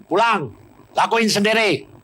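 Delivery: perintah keras dan tinggi; marah. Retold or imitated. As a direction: usir dengan marah. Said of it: "marah dua-dua". (pendeta mengusir dengan marah)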